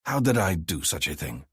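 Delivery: plain reading voice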